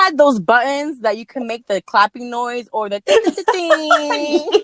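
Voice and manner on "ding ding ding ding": slow sing-song ding ding; imitated ding sound. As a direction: chime it like a game-show bell. Imitating applause buzzer sound.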